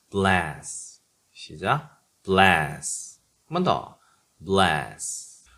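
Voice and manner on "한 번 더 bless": normal talking voice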